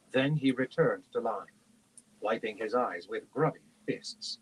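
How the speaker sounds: plain reading voice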